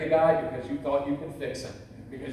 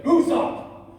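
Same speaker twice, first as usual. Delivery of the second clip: sharp high yell from an angry military dad; retold yell; 9 semitones higher, 4 dB louder than usual. Angry father yelling at a creaky floorboard at night. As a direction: bark it down the dark hallway, loud and high. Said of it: "my dad would yell out".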